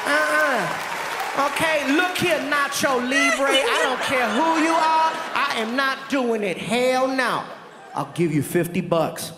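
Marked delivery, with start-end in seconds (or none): 0.1-7.4 high, firm sassy no; sassy refusal. Retold or imitated. Friend refusing the prank, sassy and firm. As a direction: lay down the law.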